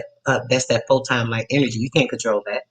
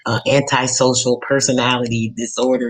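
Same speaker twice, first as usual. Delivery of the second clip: ordinary talk, nothing marked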